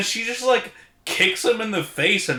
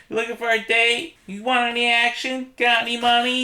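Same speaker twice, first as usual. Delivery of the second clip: high, sleazy come-on pitched way up; hooker voice; 8 semitones higher than usual. Retold or imitated. Podcast host voicing Frankenhooker propositioning men, high mock hooker voice. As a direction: toss out the pickup line like a streetwalker. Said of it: "your voiceover of the hooker quotes".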